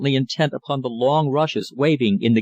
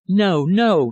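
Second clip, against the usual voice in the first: a sharp raised no, no; mildly raised protest; cried out; 6 semitones higher, 4 dB louder than usual. A narrator's reading. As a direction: cry it out to stop him. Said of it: "she cried".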